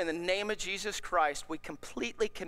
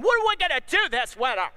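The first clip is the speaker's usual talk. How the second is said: higher, louder fretting bird voice, hammed-up; mock panicky birds; 6 semitones higher, 5 dB louder than usual. Pastor voicing birds that worry about winter, mock panic. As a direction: play a bird fussing over winter food. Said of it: "flying around freaking out".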